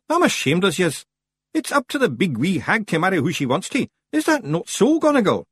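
high, indignant Scottish figure; Rob Anybody scolding. A narrator's reading. Rob shaming the Feegles, indignant. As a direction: scold them, pitched well up.

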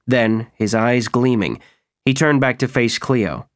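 ordinary delivery, nothing marked

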